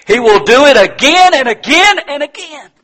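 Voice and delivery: high, loud, ringing repetition; full-force preaching. Real, not acted. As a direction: proclaim it with rising fervor.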